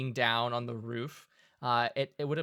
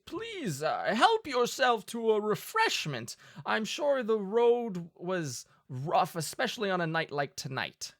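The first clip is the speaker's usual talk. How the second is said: high, hearty host's invitation; jolly duke voice; 7 semitones higher than usual. Acted. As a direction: offer the wine like a merry host.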